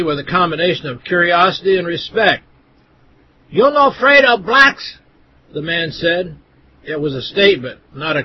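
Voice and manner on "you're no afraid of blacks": much higher, lightly put-on accented voice; a caricatured shopkeeper's question, pitched way up; mock accented shopkeeper. Shopkeeper asks the narrator, in a high put-on voice.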